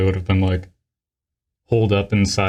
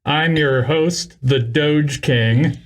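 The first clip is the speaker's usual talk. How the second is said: slow announcer; 2 semitones higher, much slower than usual. Acted. Podcast host persona intro.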